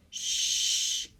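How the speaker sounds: a sharp whispered shush; hushing. A narrator's reading. Goldilocks hushing the others at the door. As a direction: hush everyone urgently. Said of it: "said Goldilocks".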